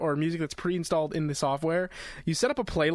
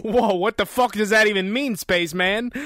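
loud exclaim; 5 semitones higher, 8 dB louder than usual. Real, not acted. Mock outrage at metric units.

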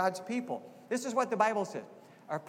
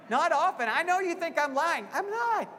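playful mock protest; 9 semitones higher, 4 dB louder than usual. Real, not acted. Teasing the congregation about Connie.

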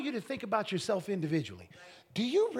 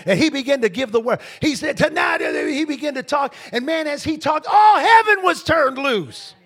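loud, high, building sermon shout; full-force preaching; 8 semitones higher, 12 dB louder than usual. Acted. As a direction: preach it, climbing louder and higher.

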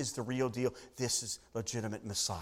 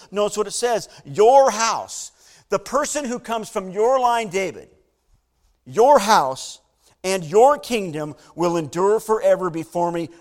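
loud, ringing preacher voice, driving each line home; a pastor proclaiming the promise to David, loud and fervent; preaching at full force; 4 semitones higher, 11 dB louder than usual. Acted. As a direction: preach it to the back pews.

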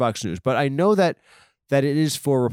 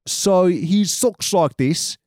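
bad Australian accent; 6 semitones higher, much slower than usual. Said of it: "I think I can't do accents anymore".

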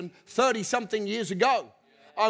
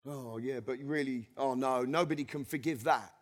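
mock doubter, much softer and lower; doubting believer; 10 semitones lower, 10 dB quieter than usual. Preacher mimicking a forgiven person who doubts, softly muttering. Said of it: "Sometimes people just don't get it".

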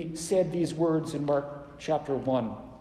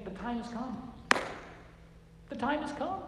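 soft, higher, hushed; hushed proclamation; 7 semitones higher, 9 dB quieter than usual. Retold or imitated. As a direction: say it gently, almost breathing it. Jesus voiced gently.

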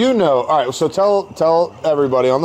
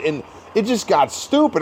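ordinary delivery

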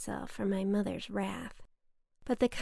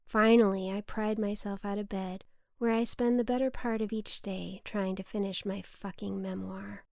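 usual speaking voice

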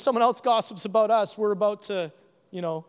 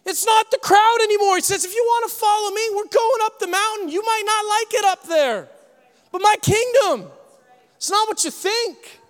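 raised, high-pitched sermon cry; loud, pitched-up preaching; preaching at full force; 11 semitones higher, 5 dB louder than usual. Acted. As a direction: proclaim it to the whole crowd.

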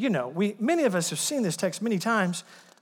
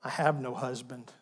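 ashamed murmur; 5 semitones lower, 5 dB quieter than usual. Acted. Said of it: "she drops her head".

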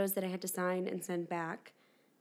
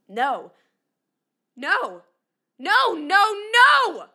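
rising no into scream; 9 dB louder than usual. Acted. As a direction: break from refusal into a scream. Breaking down at the hairdresser.

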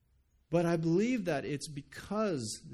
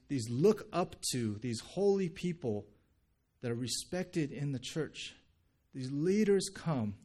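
ordinary talk

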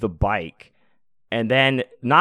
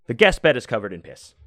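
ordinary talk

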